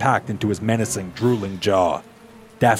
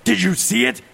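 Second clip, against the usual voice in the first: urgent man; 11 semitones higher than usual. Acted. Man voiced asking urgently.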